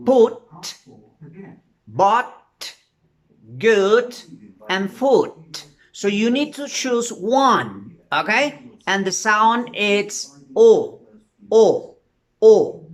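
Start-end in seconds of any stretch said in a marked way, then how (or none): 0.1-5.7 put, but, good, foot each said slowly and separately; modelling example words. Shown as a demonstration. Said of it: "For example". 8.9-12.9 O repeated, growing louder each time; emphatic O sound. Shown as a demonstration. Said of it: "Pronounce O".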